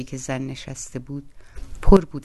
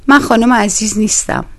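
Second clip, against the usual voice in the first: higher, louder cut-in; interrupting sharply; 9 semitones higher, 8 dB louder than usual. Acted. Doorkeeper woman interrupts Koroviev, higher and louder. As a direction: snap back, cutting him off. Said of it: "زن دوید وسط حرفش".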